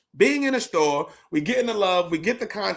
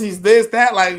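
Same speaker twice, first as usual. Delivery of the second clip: ordinary talk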